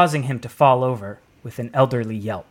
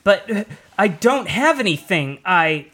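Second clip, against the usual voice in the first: higher nervous stammer; stammering fear; 6 semitones higher than usual. A narrator's reading. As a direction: stumble over it scared. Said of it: "stammered the man".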